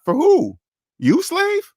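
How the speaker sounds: very high-pitched incredulous questions; mocking disbelief. Real, not acted. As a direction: squeak it in mock disbelief. Mocks the slave saying just quoted.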